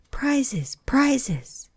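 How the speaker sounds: high excited bird chorus; a jumble of small voices clamouring; confused calling out. Acted. The whole party crowds round Alice, calling high and excited. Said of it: "calling out in a confused way".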